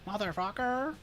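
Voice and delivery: a hammed-up Canadian accent curse; Canadian accent imitation. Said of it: "it's their accent".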